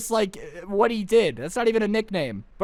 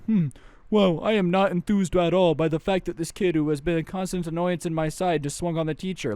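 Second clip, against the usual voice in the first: stuffy unimpressed student. Retold or imitated. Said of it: "do you expect people".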